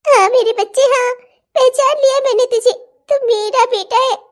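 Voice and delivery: high, loud, laughing through tears; mother crying and laughing as she recognises her son; tearful joy. Acted. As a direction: sob out the recognition.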